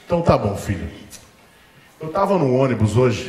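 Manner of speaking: a father setting a trap; calm stern father. Retold or imitated. Father reveals he saw.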